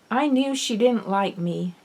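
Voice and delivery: usual speaking voice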